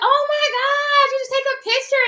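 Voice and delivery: excited friend. Retold or imitated. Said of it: "And she was like".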